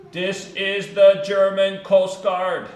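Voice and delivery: high, loud, slow radio reply; German coast guard rookie. Retold or imitated. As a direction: announce it slowly and loudly as a German radio operator. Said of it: "and it's in Germany".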